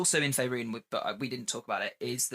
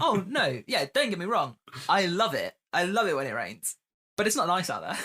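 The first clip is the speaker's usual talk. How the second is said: higher, louder protesting voice; defensive; 6 semitones higher, 4 dB louder than usual. Retold or imitated. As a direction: protest a little too much. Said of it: "And I'm like".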